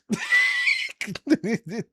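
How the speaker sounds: a high whooping laugh that rises; squealing with laughter; shrieking laugh. Real, not acted. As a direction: let out a delighted shriek of laughter. Gleeful shriek at chud's comeuppance.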